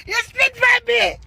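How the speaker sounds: furious high-pitched yell; retold shout. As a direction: yell it up high at the man who spat on you. Confronting the security man again.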